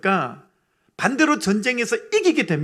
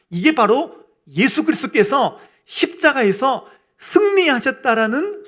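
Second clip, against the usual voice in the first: raised, higher voice driving each phrase home; building, emphatic sermon delivery; impassioned preaching; 5 semitones higher, 5 dB louder than usual. Real, not acted. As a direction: preach it with full conviction to the congregation. Preaches with rising force.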